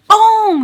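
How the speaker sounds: explosive, high, loud "boom"; very loud booming sound effect; imitated hit. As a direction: shout the impact like a cartoon blow. Imitated blow in retold fight.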